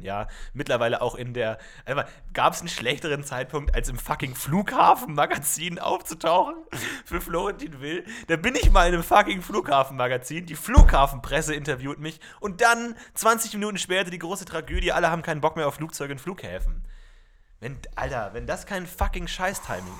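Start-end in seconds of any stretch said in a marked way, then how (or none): 3.7-15.7 mock outrage. Outraged rant about bad timing.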